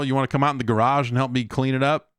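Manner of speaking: ordinary talk, nothing marked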